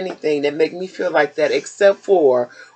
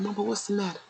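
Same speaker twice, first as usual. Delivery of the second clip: son's voice; 3 semitones higher, 12 dB quieter, faster than usual. Retold or imitated. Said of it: "my oldest son come, he said".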